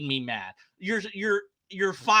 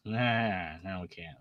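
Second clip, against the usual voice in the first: ordinary talk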